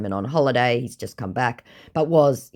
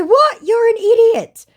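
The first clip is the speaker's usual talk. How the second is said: very high, loud What!; outraged shout; 10 dB louder than usual. Retold or imitated. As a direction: blurt it out in disbelief. Her outraged retort.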